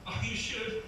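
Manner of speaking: usual speaking voice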